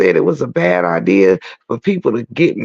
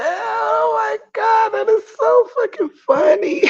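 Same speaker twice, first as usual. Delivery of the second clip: laughing scream. Real, not acted. Cracking up at a joke.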